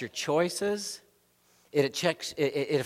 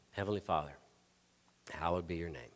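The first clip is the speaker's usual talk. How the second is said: softer, lower, a hushed plea; quiet murmured prayer; 9 semitones lower, 11 dB quieter, faster than usual. Shown as a demonstration. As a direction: breathe the words under your breath. Praying to recenter himself.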